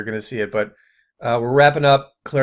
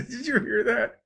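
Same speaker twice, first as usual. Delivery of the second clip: high, softer, laughing question; amused disbelief; 10 semitones higher, 5 dB quieter than usual. Real, not acted. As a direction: ask it through real laughter. Amused reaction to the clip.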